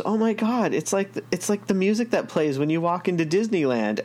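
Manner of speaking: high, amazed retelling of his wife; wife's amazed reaction. Retold or imitated. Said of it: "she goes".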